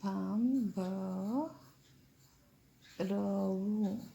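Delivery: ભાંભરવું drawn out very slowly, broken into parts; slow dictation. Shown as a demonstration. Teacher dictating ભાંભરવું for pupils to write, very slow and syllable by syllable. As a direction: stretch each syllable of ભાંભરવું, pausing midway.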